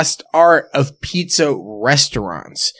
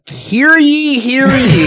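town crier; 10 semitones higher, 5 dB louder, much slower than usual. Pretend. Medieval pizza announcement.

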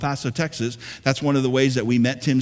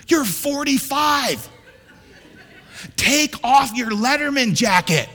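sudden slow shout, much higher; mock shout; 8 semitones higher than usual. Real, not acted. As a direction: bark it out as a joke. Mock scolding the congregation.

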